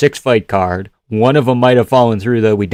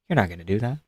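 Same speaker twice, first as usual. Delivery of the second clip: calm brush-off; 3 semitones lower, 11 dB quieter, much faster than usual. Retold or imitated. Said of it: "That's a reenactment".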